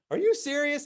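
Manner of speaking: high-pitched incredulous question; acted-out stunned attendant; shocked disbelief. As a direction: ask it aghast, pitch leaping up.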